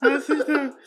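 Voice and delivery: after laughing hard. Real, not acted. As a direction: still laughing. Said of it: "Eu tô chorando de rir".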